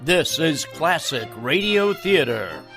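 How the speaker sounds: usual speaking voice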